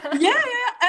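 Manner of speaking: high-pitched laughing yeah yeah; laughing yes. Real, not acted. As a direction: laugh the words out. Laughing at the caller story.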